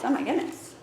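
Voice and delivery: normal talking voice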